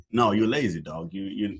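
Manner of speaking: ordinary talk, nothing marked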